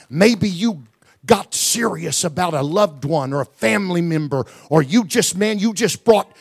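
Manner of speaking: rising to a loud, high, pounding exhortation; preacher calling the church to act, in a thundering crescendo; full-force preaching. Acted. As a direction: build the congregation to a fever pitch. Preacher climbs to a full altar call.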